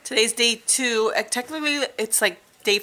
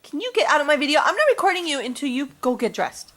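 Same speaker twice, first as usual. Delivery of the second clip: louder, higher telling off; 7 semitones higher, 5 dB louder than usual. Real, not acted. Chasing child out of video.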